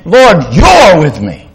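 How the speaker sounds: slow, lifted exclamation; excited. Acted. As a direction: savour it with joy. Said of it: "He's so excited about this".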